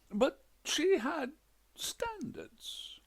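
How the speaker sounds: slow and very high, strained; fussy widower. A narrator's reading. Widower complains about his wife, slow and high. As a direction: squeeze out the complaint in a high pitch.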